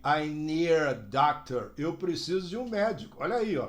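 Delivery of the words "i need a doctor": need a linked as nee-ra; I need a doctor with the D of need flapped into a; American flap. Shown as a demonstration. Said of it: "E aí".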